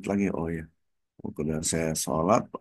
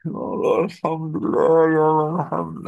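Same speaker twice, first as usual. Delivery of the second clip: tearful thanksgiving; 9 semitones higher, 5 dB louder than usual. Retold or imitated. Wife praising God as husband sleeps, imitated tearful wail. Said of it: "nangis istri".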